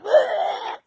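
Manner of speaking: loud, high gagging wail; disgusted retch. Retold or imitated. Telling of the mayonnaise whiff, loud mock gag. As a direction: cry out as if about to retch.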